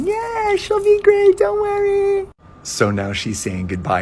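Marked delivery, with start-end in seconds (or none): 0.0-2.3 very high, loud squealing voices; extremely high girly chorus; friends' squeal. Retold or imitated. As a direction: shriek reassurance like tipsy friends. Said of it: "all her friends are like".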